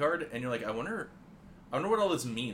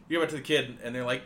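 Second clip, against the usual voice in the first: ordinary talk, nothing marked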